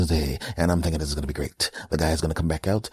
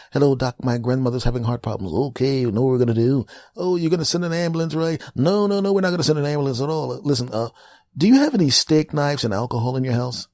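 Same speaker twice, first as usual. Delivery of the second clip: mock doctor call; 7 semitones higher than usual. Imagined doctor phone call.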